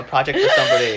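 choked up laughing about an archive project. Real, not acted. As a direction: laugh as feelings catch you. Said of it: "He just got verklempt".

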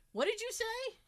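high-pitched mock call-out; retold yell. Retelling how Jay called from the living room, mock yell. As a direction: imitate someone hard of hearing calling across the house. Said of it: "yells out to me".

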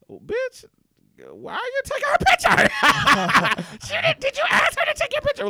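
high, loud, laughing sass; sassy mock confrontation. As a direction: call them out, cackling. Mocking confrontation of photographers.

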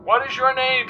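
jaunty talk-radio host. A narrator's reading. Arlo Wombat quizzing the weatherman on air, breezy host.

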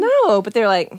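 usual speaking voice

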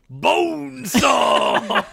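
slow shouted drawn-out word; Macho Man impression. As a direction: stretch it out like a wrestler. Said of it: "the word that gets me there".

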